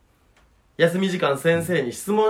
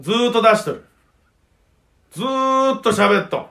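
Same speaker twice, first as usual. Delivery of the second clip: comedian stage voice; 6 semitones higher, 4 dB louder than usual. Shown as a demonstration. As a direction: show off the showman voice at full volume. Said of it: "これ、これです。". (芸人モードの張った声)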